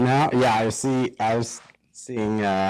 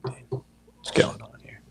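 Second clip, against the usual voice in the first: whispering; 2 semitones higher, 6 dB quieter than usual. Real, not acted. Confused by the broken stream audio.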